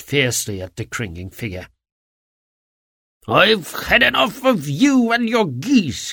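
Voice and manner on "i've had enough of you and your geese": angry shout, higher and slow; a salesman losing patience, bellowing. A narrator's reading. Angry salesman shouting at the cringing little man. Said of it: "he shouted".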